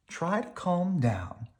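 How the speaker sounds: Mama calming nervous cubs; soothing Mama. A narrator's reading. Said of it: "said Mama".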